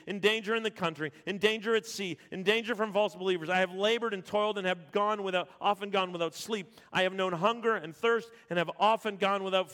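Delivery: loud, high, driving sermon voice; full-force preaching. Acted. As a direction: preach it out to the whole church.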